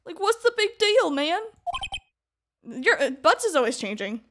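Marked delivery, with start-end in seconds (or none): 0.0-1.4 high-pitched, indignant goofy witness; flustered witness. Acted. Witness defending his radio to judge, indignant high voice. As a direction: protest in a high goofball voice. Plays Larry Butts on stand.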